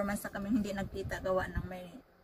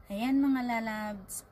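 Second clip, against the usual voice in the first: drawn-out call; much slower than usual. Real, not acted. Drawn out address to viewers.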